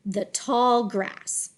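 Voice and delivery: slow, connected "the tall grass"; deliberate, flowing "the tall grass"; smooth phrase reading. Shown as a demonstration. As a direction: glide through "the tall grass" as one phrase. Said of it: "read smoothly in phrases".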